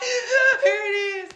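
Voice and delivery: high, drawn-out mock whimper; hammed-up tearful squeak; imitated crying. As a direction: wail it slowly through pretend tears. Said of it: "I'm crying".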